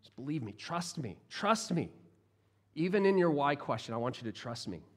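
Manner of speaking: quiet, tender reassurance of a friend; gentle Jesus. Acted. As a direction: soothe her gently, trust me. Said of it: "It's such a gentle response".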